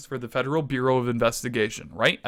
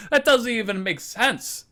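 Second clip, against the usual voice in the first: high-pitched disbelief; incredulous and fed up; exasperated; 8 semitones higher than usual. Acted. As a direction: protest it in a higher voice. Exasperated rant over a silly abbreviation.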